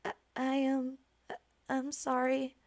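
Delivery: soft, stammered apology; small, hesitant voice of a scolded maid; timid apology. Acted. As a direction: apologize meekly, stumbling on the first word.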